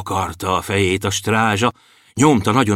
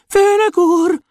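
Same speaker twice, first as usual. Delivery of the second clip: very high dwarf cry for help; desperate thin-voiced shout; 4 dB louder, slower than usual. A narrator's reading. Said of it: "kétségbeesve kiabáltak". (kétségbeesett, vékony, magas kiáltás)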